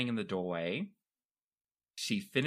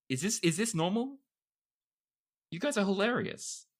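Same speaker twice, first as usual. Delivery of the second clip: higher, bright-eyed guest voice; lightly voiced guest marveling at the group; delighted newcomer; 9 semitones higher than usual. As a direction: play the thrilled newcomer at the table.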